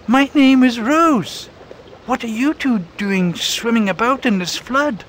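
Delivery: very high, curious bird voice; a little bird asking questions; Rose the robin. Acted. Robin asking the two why they swim in the flood, high and curious. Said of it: "She sat up and said".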